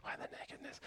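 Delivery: hushed, breathy aside to the room; a conspiratorial whispered question. Acted. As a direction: lean in and whisper it.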